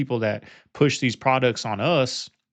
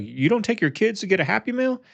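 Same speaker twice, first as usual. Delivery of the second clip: much higher, mock astonished question; shocked disbelief; 9 semitones higher than usual. Podcast host stunned that a guest skips Happy Meals, mock astonished and much higher. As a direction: sound floored on his behalf. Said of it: "He's like".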